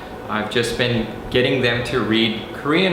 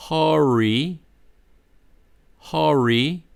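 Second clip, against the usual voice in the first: American R; 4 semitones higher, slower than usual. Shown as a demonstration. Teacher modelling 허리 as hurry, American R. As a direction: hurry with a curled American R, rising. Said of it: "we're going to read that as".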